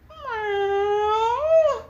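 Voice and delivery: long, high falling meow; cat meow. Retold or imitated. Lost cat meowing up to her owner, long high meow. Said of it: "She goes".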